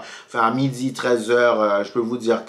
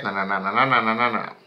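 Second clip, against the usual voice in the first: râlerie imitée; 3 semitones lower than usual. Retold or imitated. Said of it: "elle commence déjà à râler".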